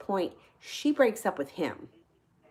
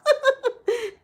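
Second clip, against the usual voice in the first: loud, very high laughing fit; big laugh; 6 dB louder than usual. Real, not acted. Storyteller cracks up at friend's reaction, laughing.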